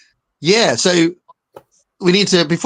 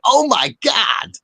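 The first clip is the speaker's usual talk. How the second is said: high, slow, drawn-out American "Oh my God!"; American accent; 9 semitones higher, much slower than usual. Retold or imitated. Host imitating an amazed American, slow American accent. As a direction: stretch it out like a stunned American tourist.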